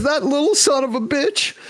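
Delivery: high-pitched, upset retold curse; hammed-up crying agent, pitched high; mock tearful outburst. Agent crying on the phone about her client, mock tearful. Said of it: "She's crying like crazy".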